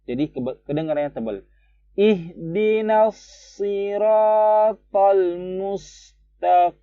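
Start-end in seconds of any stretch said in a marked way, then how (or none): 2.0-6.8 high, slow chanted tilawah; Quran recitation. Shown as a demonstration. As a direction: recite slowly and steadily. (tilawah tinggi dan pelan)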